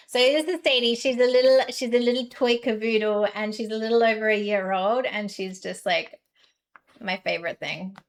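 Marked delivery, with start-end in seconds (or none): none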